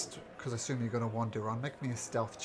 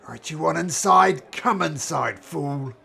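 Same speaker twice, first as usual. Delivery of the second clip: booming order, much louder; loud gruff command; 5 semitones higher, 15 dB louder than usual. Acted. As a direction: shout the order at him. Dwarf barkeep orders him in.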